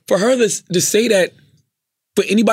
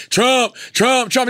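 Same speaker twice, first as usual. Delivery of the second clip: imitated crowd; 6 semitones higher, much slower than usual. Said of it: "everybody was like".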